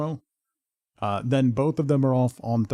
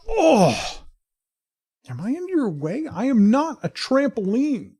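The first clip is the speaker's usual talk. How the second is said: startled high yelp; 11 semitones higher, 4 dB louder, slower than usual. Real, not acted. Dog jumps on him.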